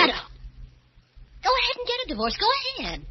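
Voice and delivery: high, bitter shout at husband; wife flaring up at her jealous husband; furious outburst. Acted. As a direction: tell him to get a divorce in a rage. Wife's quarrel outburst.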